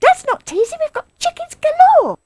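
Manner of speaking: very high, loud, excited cub voices; tiny animal children piping up; small foxes. A narrator's reading. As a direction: squeal it out eagerly. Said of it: "cried the small foxes".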